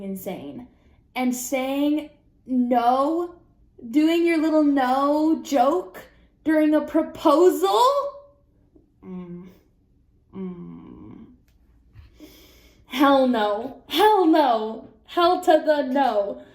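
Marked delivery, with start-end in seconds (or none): 1.2-7.8 higher, louder, slow incredulous build; can't believe someone did that at a proposal; outraged disbelief. Real, not acted. Outraged at the joke refusal. 12.9-13.8 emphatic hell no. Real, not acted. Emphatic refusal. 13.9-16.4 higher and louder, the refusal climbs; sassy escalating refusal, slowing on the last; bigger hell no. Real, not acted.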